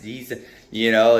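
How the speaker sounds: ordinary delivery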